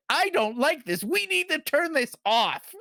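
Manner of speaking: imitating an upset child, high-pitched; retold tearful protest. Mother imitates five-year-old upset by the movie, mock distressed. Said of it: "like, she starts crying".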